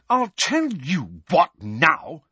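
high, slow, seething threat; towering temper, spitting it out slowly; angry teammate. A narrator's reading. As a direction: lean into each word, furious. Angelina furious at Harry.

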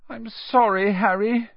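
high, distressed apology; upset girl wailing an apology. A narrator's reading. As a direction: cry out in dismay. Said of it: "she wailed".

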